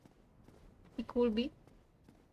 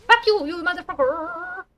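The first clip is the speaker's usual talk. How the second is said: loud, higher burst of swearing; angry cursing; 4 semitones higher, 8 dB louder than usual. Real, not acted. Streamer swearing at the game enemy, angry outburst. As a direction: lash out at the enemy, loudly.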